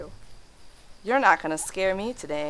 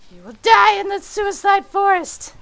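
1 spooky menace voice; 12 semitones higher, 5 dB louder than usual. Acted. Mock spooky threat voice.

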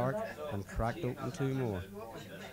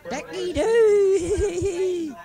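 long drawn-out howl, much higher and louder; cackling howl; 12 dB louder, much slower than usual. Acted. Drunk driver howling his catchphrase into the night, wild cry. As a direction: let rip a wild crazed cry. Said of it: "he cackled".

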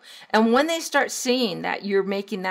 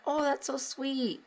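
high, soft cooing; tender, higher-pitched acted reaction; touched; 6 semitones higher, 7 dB quieter than usual. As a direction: melt softly as if charmed by a child. Acting out a touched parent's reaction.

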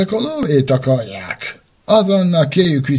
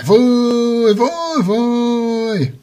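boo the fraud; 8 semitones higher, much slower than usual. Acted. Children boo the fake.

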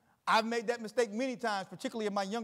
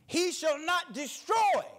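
slow, high shout of "destroy"; "destroy" shouted slow and high from the pulpit; preached shout; 4 semitones higher, slower than usual. Shown as a demonstration. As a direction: bring the house down on "destroy". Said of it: "now I want you to underline this word, destroy".

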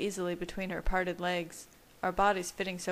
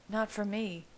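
usual speaking voice

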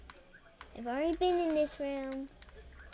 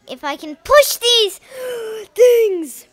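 loud, higher straining yell with a gasp; frustrated strain; 10 semitones higher, 15 dB louder than usual. Real, not acted. Player fighting the puzzle, loud frustrated strain. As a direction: shove the words out in real frustration. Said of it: "I'm getting so mad".

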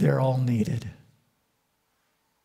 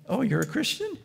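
teasing; 8 semitones higher than usual. Retold or imitated. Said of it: "stir up evangelicals by saying".

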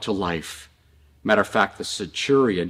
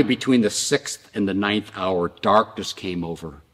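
ordinary talk

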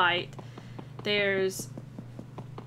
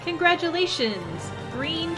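higher, cheerful announcement; excited; 8 semitones higher, 3 dB louder than usual. Acted. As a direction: announce it with joy. Reading celebration banner.

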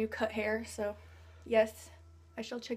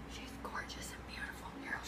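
whispering; 10 dB quieter than usual. Real, not acted. Gushing over a game character.

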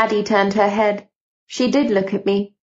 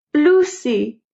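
higher sudden cry of a name; 6 semitones higher than usual. Acted. Aunt in a trance crying out to Lucy, sudden cry. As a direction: call out Lucy from inside a trance. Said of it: "someone cried".

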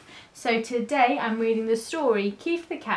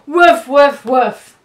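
very loud, punchy woofs; dog barking; 3 semitones higher, 14 dB louder, slower than usual. A narrator's reading. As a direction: bark it like a dog. Barking dog sound effect.

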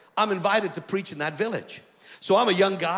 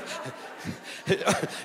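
helpless low laugh breaking into the story; real laughter; 4 semitones lower, 6 dB quieter than usual. Real, not acted. Preacher amused by his own altar call, real laughter. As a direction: crack up mid-story.

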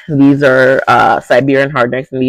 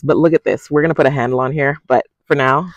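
usual speaking voice

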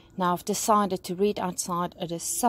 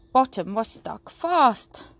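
plain reading voice

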